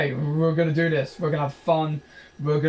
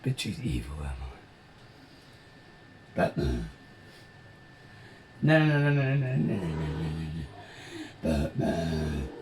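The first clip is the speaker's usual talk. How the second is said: lower, softer villain taunt; a hint of a growling bad guy calling out; lightly voiced villain; 11 semitones lower, 6 dB quieter than usual. Taunting Batman to come out, lightly low villain voice.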